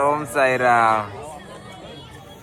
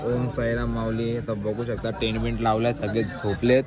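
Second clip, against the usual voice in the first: quiet at night; 4 semitones lower, 7 dB quieter than usual. Real, not acted. Everyone asleep in tents at night.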